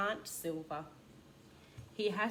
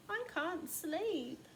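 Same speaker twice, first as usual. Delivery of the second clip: small high sleepy voice; 10 semitones higher, slower than usual. Acted. Spoon at night to mum.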